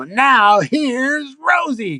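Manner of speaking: put-on high, slow old-lady announcer; announcer intro. Writer reading sketch, voicing old lady announcing Rosie. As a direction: stretch it out like a spunky old lady introducing the host.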